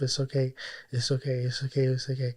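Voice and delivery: soft murmured reassurance to yourself; quiet repeated comfort, trembling inside; soothing self-talk. Retold or imitated. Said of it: "Telling myself when I'm shaking".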